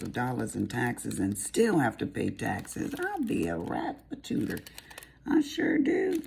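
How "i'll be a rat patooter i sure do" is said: country voice. Pretend. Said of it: "That's my country voice".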